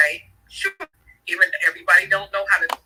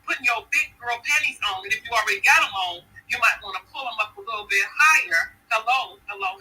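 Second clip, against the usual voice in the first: usual speaking voice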